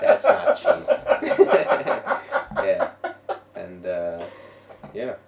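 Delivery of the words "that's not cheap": breaking into high laughter; laughing. Real, not acted. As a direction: laugh the words out. Joking about cocaine costs.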